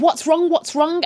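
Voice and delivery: high, urgent acted questions; mock alarm. Family runs up the stairs to her, mock alarm. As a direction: imitate worried relatives rushing in. Relatives rush upstairs hearing screaming.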